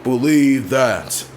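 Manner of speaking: hammed-up Roman Reigns impression; mock Roman Reigns catchphrase. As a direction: stretch it out like a bored wrestler's catchphrase. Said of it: "he would say things like".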